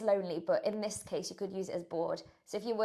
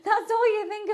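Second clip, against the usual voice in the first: laughing; 12 semitones higher, 10 dB louder than usual. Real, not acted. Laughing at the chocolate craving.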